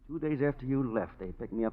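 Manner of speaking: normal talking voice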